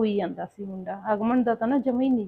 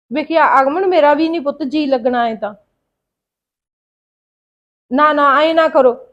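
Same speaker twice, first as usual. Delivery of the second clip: loud, pained pleading into the phone; pleading loudly; 4 semitones higher, 8 dB louder than usual. Acted. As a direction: beg the kids to stop crying. Mother pleading with crying children. (ਫੋਨ ਤੇ ਰੋਂਦੇ ਬੱਚਿਆਂ ਨੂੰ ਉੱਚੀ ਵਾਸਤਾ ਪਾਉਣਾ)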